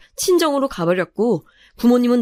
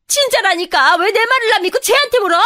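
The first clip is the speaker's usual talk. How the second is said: very high, loud protest; indignant yelling; 11 semitones higher, 4 dB louder than usual. Acted. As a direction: shriek at not being believed. Mother-in-law protests being doubted.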